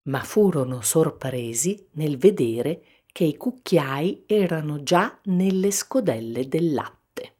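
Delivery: ordinary delivery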